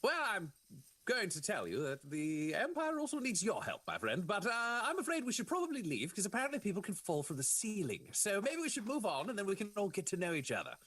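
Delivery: high, swooping Inquisitor voice; theatrical, high-pitched courtesy; courteous Inquisitor. Acted. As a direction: politely usher a new ally along. Plays Inquisitor answering the soldier.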